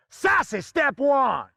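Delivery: drawn-out sassy announcement, slightly high; big sassy stage call. Acted. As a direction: announce it with slow, showy sass. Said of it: "what I like to call the sassy way".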